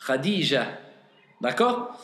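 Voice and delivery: ordinary talk, nothing marked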